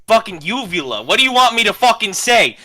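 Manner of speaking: loud, exasperated arguing; frustrated yelling. Real, not acted. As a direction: push back hard, fed up. Player argues with the GM.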